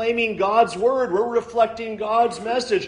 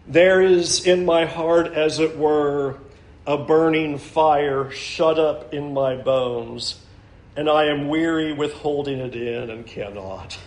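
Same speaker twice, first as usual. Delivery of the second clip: slow, low, weighty reading of the verse; reading scripture to the congregation, slow and low; solemn scripture reading; 6 semitones lower than usual. Acted. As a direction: let each word burn slowly, lower and heavier.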